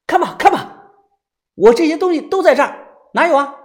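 high, loud, hurried challenge; indignant and shrill-pitched; fuming. A narrator's reading. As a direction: dare them to look, worked up. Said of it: "气冲冲".

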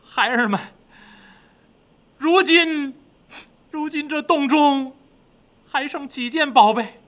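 high, tearful demon king; slow, high, choked-up grief; holding back grief. A narrator's reading. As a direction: fight down sobs while questioning the minions. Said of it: "强忍悲痛".

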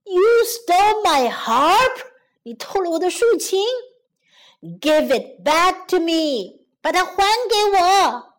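loud, accusing giant; angry shouting. Acted. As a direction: shout angrily after a thief. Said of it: "愤怒地喊着". (愤怒地大喊)

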